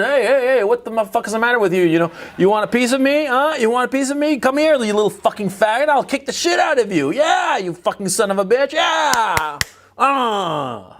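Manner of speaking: high-pitched taunting tough guy; egging someone on to fight; belligerent drunk. Retold or imitated. Acted street-fight taunt.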